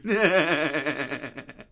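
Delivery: loud, sustained cackle; mocking laugh. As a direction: laugh heartily right in his face. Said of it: "I'm already ahead of you".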